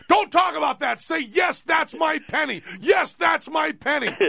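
high, loud yelling imitation of Teller; imitated scream. Teller yelling at his patient to say the line. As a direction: shout it over and over, insisting. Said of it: "he's screaming in my face".

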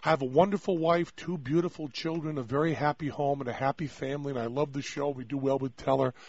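low, soft, joyless listing; subdued crumbled-man voice; mock despair. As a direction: count the blessings as if they mean nothing. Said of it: "my whole life crumbled".

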